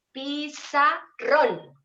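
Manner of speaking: Pizarrón said very slowly, syllable by syllable, leaning on RRÓN; stress on last syllable. Shown as a demonstration. Teacher showing students the tonic syllable, Pizarrón slow with stressed RRÓN. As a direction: draw out Pizarrón in three parts, final RRÓN stressed. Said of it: "una fuerza más en la última sílaba".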